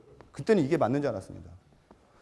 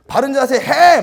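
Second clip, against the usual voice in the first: instructor's yell; 10 semitones higher, 12 dB louder, much slower than usual. Acted. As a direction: shout 바른 자세 해 at the students. Acting yelling instructor. (높고 크게 외치는 사범)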